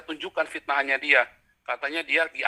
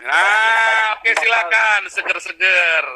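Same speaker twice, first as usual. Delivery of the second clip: loud and higher, hailing someone; calling out; 5 semitones higher, 5 dB louder, much slower than usual. Real, not acted. Host calling the caller to switch on his camera: loud, high call. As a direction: raise the voice to get him on the line.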